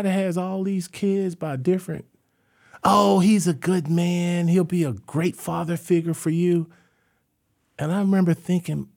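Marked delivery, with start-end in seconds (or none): 2.8-6.7 mom vouching. Retold or imitated. Mom praising Milton.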